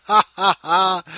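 big loud burst of laughter; real laugh at the neighbor's dog mess. Real, not acted. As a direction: cackle loudly at your own joke. Laughs at own rant.

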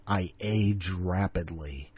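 put-on wheezing old man groan; aged croak. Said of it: "old man voice for too long".

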